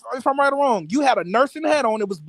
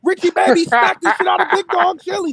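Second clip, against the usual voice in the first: high-pitched, excited mock play-by-play; mock fight commentator; 8 semitones higher than usual. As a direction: call the upset like a hyped announcer. Mock commentary on an imagined chair attack.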